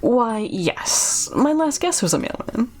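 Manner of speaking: polite but sinister, trailing into an ominous pause; hint darkly with false courtesy; eerie host, drawing out the ending; creepy innkeeper. Acted. Voices Chuck hinting at guest's fate.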